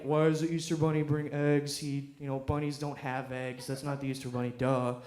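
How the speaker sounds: low, soft mock know-it-all; understated impression of a smug corrector; know-it-all guy. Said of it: "the guy who's like".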